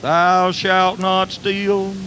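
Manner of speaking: slow, solemn box voice on the commandment; box as conscience. Retold or imitated. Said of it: "Box said".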